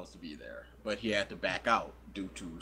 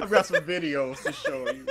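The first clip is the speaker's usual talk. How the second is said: laughing joke; 7 semitones higher than usual. Pretend. Joking creepy offer.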